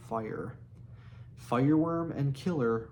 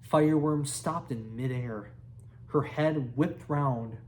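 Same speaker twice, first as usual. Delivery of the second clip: plain reading voice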